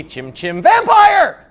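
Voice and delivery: high, loud, drawn-out yell; sudden mock shout. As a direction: burst out yelling on the word. Chimney song turns into shout.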